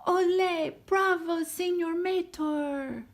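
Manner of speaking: excited crowd cheer. Acted. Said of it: "cheered the crowd".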